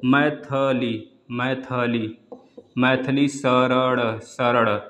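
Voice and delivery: Maithili शरण drawn out very slowly; slow spelling-out. Shown as a demonstration. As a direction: stretch Maithili शरण syllable by syllable. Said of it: "जैसे इस प्रकार से".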